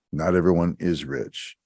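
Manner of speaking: ordinary delivery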